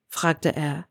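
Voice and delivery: plain reading voice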